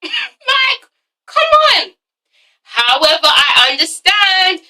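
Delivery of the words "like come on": very high, squealed plea; exasperated disbelief. Real, not acted. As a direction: protest in a high incredulous squeal. Exasperated at friend's unfeeling look.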